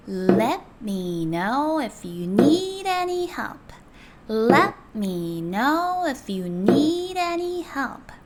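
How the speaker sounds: very slow, beat by beat; slow rhythmic drill. Shown as a demonstration. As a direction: tap out each beat evenly. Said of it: "박자에 맞춰서 마지막으로 해보고". (박자에 맞춘 느린 연습)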